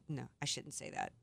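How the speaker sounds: quiet self-correction. Real, not acted. Host reins herself in after a cheeky remark, quietly. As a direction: take it back under your breath.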